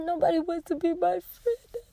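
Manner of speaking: high, soft tearful voice; imitating a crying child. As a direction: mimic a little girl about to cry. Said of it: "She said".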